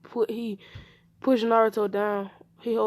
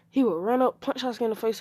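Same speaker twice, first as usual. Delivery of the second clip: ordinary delivery, nothing marked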